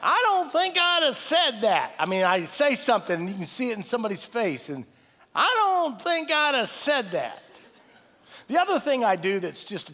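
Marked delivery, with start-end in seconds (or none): 0.0-1.9 higher, slower, drawn-out voice of conscience. Retold or imitated. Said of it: "this voice says to me". 5.4-7.4 a raised, slowed voice of conscience. Retold or imitated. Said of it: "this voice says to me".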